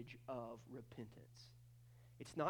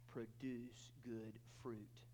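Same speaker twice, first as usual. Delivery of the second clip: slow soft emphasis; 5 semitones lower, 9 dB quieter, much slower than usual. Shown as a demonstration. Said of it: "slow soft emphasis".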